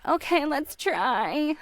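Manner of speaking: high, slow, hesitant agreement; nervous dread. Retold or imitated. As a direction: imitate agreeing reluctantly while sweating bullets. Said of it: "I'm sweating bullets at this point".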